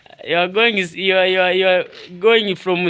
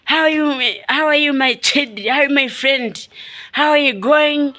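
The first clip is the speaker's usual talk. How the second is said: mock German English; 8 semitones higher than usual. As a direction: sing out greetings like a hammed-up German. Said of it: "Eh, like this. Eh, like German".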